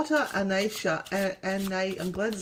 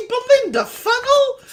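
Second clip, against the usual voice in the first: sing-song greeting; 8 dB louder than usual. Acted. High sing-song greeting of caller.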